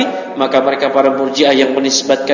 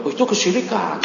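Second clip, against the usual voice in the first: mock opponent's answer; 2 semitones higher, 7 dB quieter than usual. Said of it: "Maka sang murji'i menjawab".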